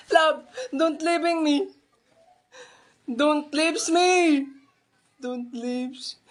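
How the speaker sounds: slow, hammed-up sobbing plea; drawn-out fake tears to a lover; mock crying plea. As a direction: beg not to be left, half crying. Begging lover not to leave.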